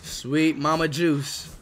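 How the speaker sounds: very slow, stretched-out words; awed drawl. Real, not acted. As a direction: savour each word, drawing it out. Awed at what the pack shows.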